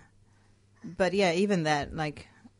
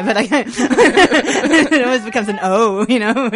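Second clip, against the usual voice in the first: laughing, much louder and higher; 8 semitones higher, 17 dB louder, slower than usual. Real, not acted. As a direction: laugh through the words. Laughing about the accent slip.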